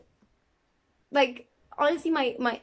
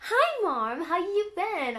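light imitation of an American accent, higher, slow on the end; 7 semitones higher, slower than usual. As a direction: greet mom in a breezy American accent. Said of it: "So I was like".

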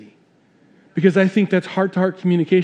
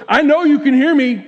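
ranting coworker; 7 semitones higher, 3 dB louder than usual. Retold or imitated. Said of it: "And she goes".

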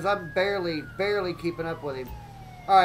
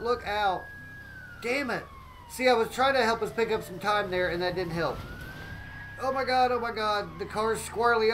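ordinary delivery

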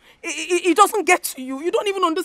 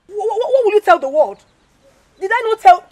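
stammering, fast and loud, a bit higher; angry outburst; 5 semitones higher, 9 dB louder, faster than usual. Acted. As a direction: burst out at your mother. Daughter quarrels with mother.

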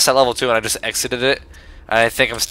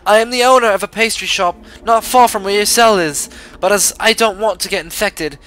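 a lightly put-on British lady voice, a touch higher; mild British accent; 7 semitones higher than usual. Reading pastry owner Charlotte's letter, mild put-on British accent. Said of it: "My British accent is not on par today".

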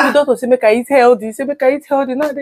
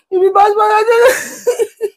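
high, slow, half-laughing fake sob; mock weeping at husband, laughing through it; fake crying wail; 9 semitones higher, much slower than usual. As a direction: drag it out in a hammed-up cry.

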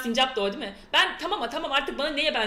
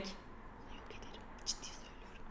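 whispered deadpan vow; menacing whisper; 22 dB quieter than usual. Pretend. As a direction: breathe it out like a quiet promise of doom. Repeats the threat, dead serious.

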